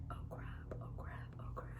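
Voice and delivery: tiny voiceless panicked whisper; scared whisper. Retold or imitated. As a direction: whisper it to yourself in alarm. Acts out fearful reaction after crash.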